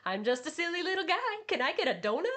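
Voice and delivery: high, quick squeaky whine; silly little guy voice. Retold or imitated. Vash begging for a donut, high and fast. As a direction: chirp it like a harmless little goof. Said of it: "him going".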